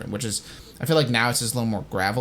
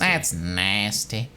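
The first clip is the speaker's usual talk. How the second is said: very slow, lower mock villain line; drawn-out imitation; 4 semitones lower, much slower than usual. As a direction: drag the words out low.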